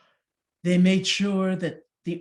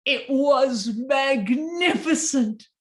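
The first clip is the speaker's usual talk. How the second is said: slow, louder marvelling; awed; 7 semitones higher, 6 dB louder, slower than usual. A narrator's reading. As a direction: savor the wonder. Turnip revealed.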